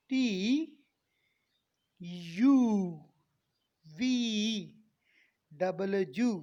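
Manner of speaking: टी, यू, वी, डबल यू each slow and held; stretched letter names. Shown as a demonstration. Teacher modelling letters for students, long separate names. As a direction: drag out the vowels in टी, यू, वी. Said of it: "थोड़ा खींच के".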